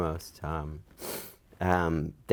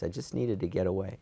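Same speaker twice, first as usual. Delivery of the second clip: usual speaking voice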